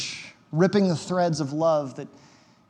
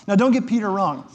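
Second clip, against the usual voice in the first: usual speaking voice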